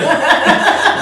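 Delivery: high, loud burst of laughter; real laugh. Real, not acted. Guest jokes about his shaky residence permit, real laughter. Amused by the wordplay on séjour.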